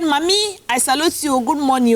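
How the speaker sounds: high, emphatic formal salute; insistent greeting. Retold or imitated. Schoolgirl salutes landlady who ignores her, high insistent greeting. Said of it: "She did not answer her".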